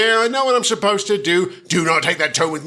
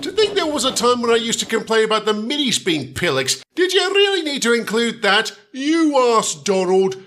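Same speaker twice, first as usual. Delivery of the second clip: usual speaking voice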